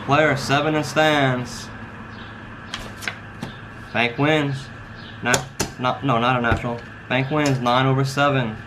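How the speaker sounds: slow, drawn-out dealer call; casino croupier. Acted. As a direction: announce the hand like a baccarat dealer. Dealer calls the baccarat hand.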